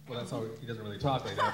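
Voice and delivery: usual speaking voice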